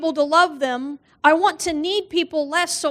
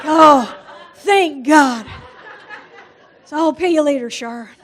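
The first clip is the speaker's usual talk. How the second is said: louder, laughing mock relief; relieved exclamation; 5 dB louder, slower than usual. Pastor relieved she'll stop expecting feeding, louder and laughing. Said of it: "He's like".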